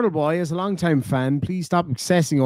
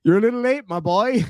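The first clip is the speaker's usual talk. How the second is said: teasing; 7 semitones higher than usual. Real, not acted. Teasing a commenter.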